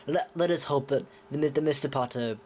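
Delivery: light British accent, imitating Lucius Malfoy. Quoting Lucius Malfoy to Harry in a British accent. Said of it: "I'm speaking in a British accent".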